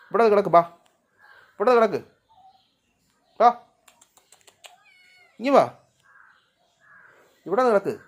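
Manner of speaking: loud commands to come and lie here; calling out. Real, not acted. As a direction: call them over firmly. Calling the pets over.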